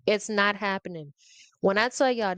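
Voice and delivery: normal talking voice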